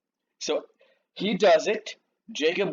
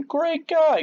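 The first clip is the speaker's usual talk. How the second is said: cheery shepherd; 14 semitones higher, 4 dB louder than usual. Retold or imitated. Shepherds praise Laban to Jacob.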